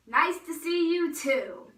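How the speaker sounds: loud, slow, drawn-out reply; mock greeting back. Girl echoing cousins' greeting back, loud drawn-out mock reply.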